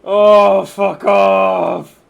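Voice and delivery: loud, high angry yell; furious shout. Real, not acted. Streamer yells in frustration at the game. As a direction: yell it at the screen.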